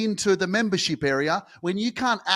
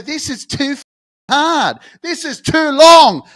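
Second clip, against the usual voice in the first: loud, exasperated cry that builds higher; frustrated complaint; 6 semitones higher, 13 dB louder than usual. Retold or imitated. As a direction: shout it as if giving up on the hard road. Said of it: "you'll go".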